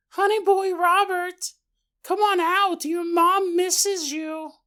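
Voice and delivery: high, slow sing-song mother voice; creepy alien mimicking a mother; eerie mother's call. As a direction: lure him out like a sweet mom.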